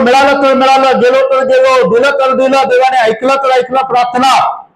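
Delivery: high, driving run of exhortation; challenging preaching. Real, not acted. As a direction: challenge the congregation in a high, insistent voice. Challenges congregation, raised pitch. (उंच स्वरात आव्हानात्मक प्रवचन)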